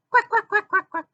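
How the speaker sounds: high, rapid cartoon quack-laugh; quacking cackle. Park troublemakers snicker at Supergirl, quacking cackle. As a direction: cackle in a string of squeaky quacks.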